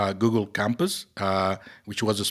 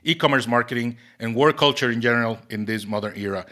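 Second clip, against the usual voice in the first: podcast intro read; 3 semitones higher, 4 dB louder than usual. Acted. Podcast host opening over music.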